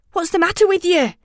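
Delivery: high, fast demand; agitated. A narrator's reading. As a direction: snap it in alarm. Frightened children arguing.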